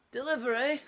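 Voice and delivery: put-on young delivery kid calling out; high, bright-pitched youngster's call; [name]. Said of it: "a quite young voice. Uh, you actually hear [name]".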